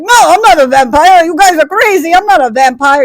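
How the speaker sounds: loud, high indignant mock denial; protesting vampire. Accused vampire answers the townspeople, loud mock protest.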